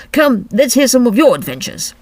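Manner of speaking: loud, brisk creature voice; Griffin voice. Acted. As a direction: bark it out like a gruff beast.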